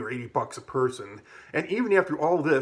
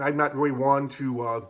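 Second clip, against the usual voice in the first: usual speaking voice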